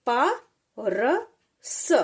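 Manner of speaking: प र स slow, letter by letter; प र स as three separate sounds; sounding out letters. Shown as a demonstration. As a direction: spell out प र स with pauses. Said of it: "जैसे".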